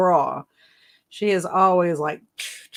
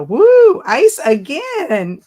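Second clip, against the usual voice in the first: excited whoop; 8 semitones higher, 8 dB louder, much slower than usual. Real, not acted. Excited reaction to chat.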